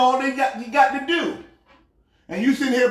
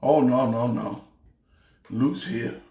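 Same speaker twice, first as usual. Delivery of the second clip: disgusted groan; 11 semitones lower, 4 dB quieter than usual. Real, not acted. Disgusted reaction to assistant.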